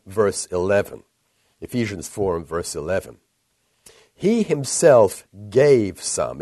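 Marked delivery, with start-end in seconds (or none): 4.1-6.4 HE HIMSELF leaned on hard, loud and very slow; HE HIMSELF GAVE SOME, each word punched out slowly; emphatic. Shown as a demonstration. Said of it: "It's very emphatic in the Greek".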